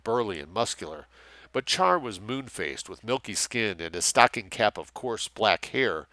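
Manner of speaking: usual speaking voice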